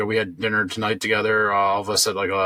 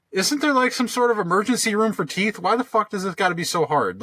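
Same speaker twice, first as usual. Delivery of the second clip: higher-pitched frustrated plea; exasperated; 9 semitones higher than usual. Retold or imitated. Patient fed up with dental offices turning them away, exasperated.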